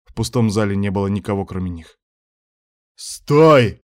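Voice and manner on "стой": much louder, higher bark. Acted. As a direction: bark the order. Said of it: "рявкнул Муса".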